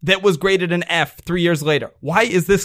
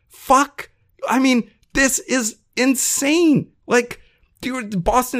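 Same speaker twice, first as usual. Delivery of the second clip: exasperated outburst; 7 semitones higher, slower than usual. Real, not acted. Co-host ranting to his partner about the Red Sox payroll, exasperated and high. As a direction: explode in real disbelief at the numbers.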